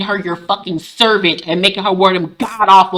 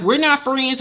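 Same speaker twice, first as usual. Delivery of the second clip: higher, curt brush-off; cold rebuff; 6 semitones higher, 4 dB quieter than usual. Retold or imitated. Rita to Fred in jail, curt higher brush-off. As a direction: cut him off coldly. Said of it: "And she was like".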